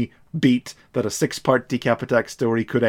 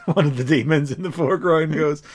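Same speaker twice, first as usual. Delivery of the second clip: usual speaking voice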